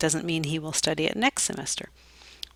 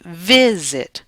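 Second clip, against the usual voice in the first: "visit" stressed up front, slow and clear; stress on first syllable; 7 dB louder than usual. Shown as a demonstration. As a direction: hit the VIS of visit, let the end drop. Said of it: "stress is on the first syllable".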